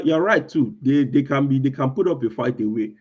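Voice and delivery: ordinary talk, nothing marked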